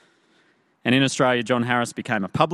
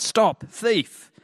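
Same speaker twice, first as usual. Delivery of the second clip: high, louder mock shout; crying out; 10 semitones higher, 4 dB louder than usual. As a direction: call out after a fleeing thief, high-pitched. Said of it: "I cried out".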